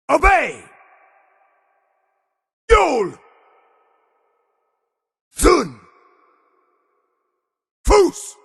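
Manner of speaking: high, explosive single-word shouts; full shout. Acted. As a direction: hurl each word out, pitch falling away. Isolated battle shouts in game.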